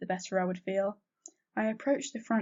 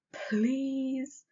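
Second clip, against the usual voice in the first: pleading please, a bit higher; begging; 5 semitones higher than usual. A narrator's reading. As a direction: plead with Sally for her silence. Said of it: "I begged".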